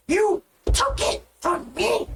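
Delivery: put-on high, slow shrieking goblin girl. Goblin girl fighting the minotaur over the satchel, high shriek. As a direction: squeal it like a greedy little goblin.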